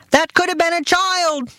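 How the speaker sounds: very high, scolding father voice; father lecturing mother over the cat; shouting father. Retold or imitated. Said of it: "my father shouted".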